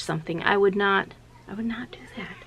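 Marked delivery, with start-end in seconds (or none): 1.5-2.4 a real whispered aside. Real, not acted. Speaker to viewers, whispering that she'd never do that. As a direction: drop to a whisper to say you'd never.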